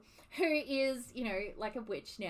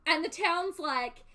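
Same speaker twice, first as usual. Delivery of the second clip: ordinary talk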